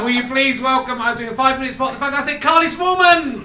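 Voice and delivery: loud, high announcer build to a shout; stage introduction. Real, not acted. Host welcomes the next act onto the stage, rousing shouted introduction. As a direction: build up and stretch out the performer's name.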